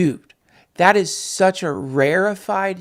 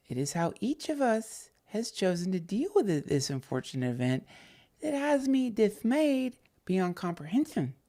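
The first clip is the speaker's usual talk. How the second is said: softer, measured dramatic reading; hushed, overly earnest letter recital; mock formal letter reading; 2 semitones higher, 8 dB quieter than usual. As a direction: read it like a wounded, solemn letter. Reads the letter aloud.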